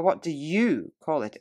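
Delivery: "you" picked out with clear emphasis; stress on you. Shown as a demonstration. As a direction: lean on "you" to point at the listener. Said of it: "The words you, had, would are all emphatic".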